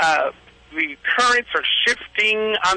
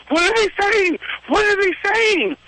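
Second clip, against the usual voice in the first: eager students asking; 14 semitones higher than usual. Retold or imitated. Students pressing the teacher about the dolphins, high and eager. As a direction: pitch it up like curious students crowding in.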